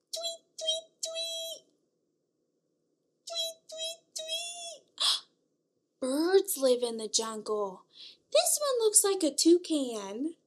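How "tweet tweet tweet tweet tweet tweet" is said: high soft tweet tweet; bird tweets. Acted. Teacher chirping bird calls for toddlers. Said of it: "The bird goes".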